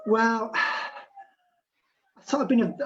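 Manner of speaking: ordinary talk, nothing marked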